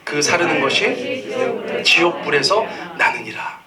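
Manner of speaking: slow, solemn communal recitation; scripture reading. Real, not acted. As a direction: read it together, weighty and slow. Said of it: "우리 같이 한번 읽어보기 원합니다". (성경을 느리게 함께 낭독하는 어조)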